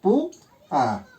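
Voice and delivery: "Pu, a" as two separate syllables; split into syllables. Shown as a demonstration. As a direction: break "Pu, a" apart for a class. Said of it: "por lo tanto se separan".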